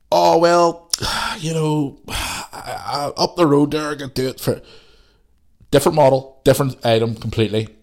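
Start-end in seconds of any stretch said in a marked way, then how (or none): 0.1-4.6 haggling customer. Retold or imitated. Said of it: "old Northern Ireland Belfast mentality".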